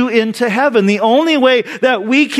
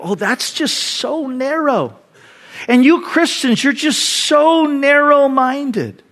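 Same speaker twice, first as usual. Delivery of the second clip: higher, slow, whiny disdain; scornful complaint; 5 semitones higher than usual. Retold or imitated. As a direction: drag out the scorn at Christians. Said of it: "people hear that and they go".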